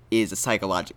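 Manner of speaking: usual speaking voice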